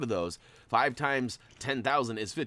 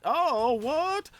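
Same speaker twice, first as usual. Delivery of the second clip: surprised; 7 dB louder than usual. Real, not acted. Surprised at reward.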